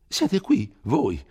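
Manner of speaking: high, loud startled cry of surprise; astonished exclamation. Acted. Old curate startled to find Renzo back in the village, exclaiming high and loud. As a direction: gasp it out as if seeing a ghost. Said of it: "esclamò Don Abbondio".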